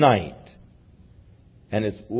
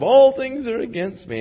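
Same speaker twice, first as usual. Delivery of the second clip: "All things are against me" pitched high and whining; whiny complaint; 12 semitones higher, 5 dB louder than usual. Retold or imitated. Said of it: "and complaining".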